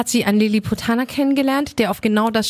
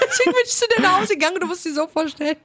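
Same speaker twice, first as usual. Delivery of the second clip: high, fast words through laughter; laughing; 8 semitones higher, faster than usual. Real, not acted. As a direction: rush the story out while laughing. Laughs retelling the shock.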